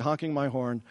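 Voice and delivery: ordinary delivery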